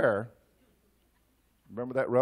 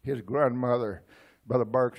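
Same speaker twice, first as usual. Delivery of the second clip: ordinary talk, nothing marked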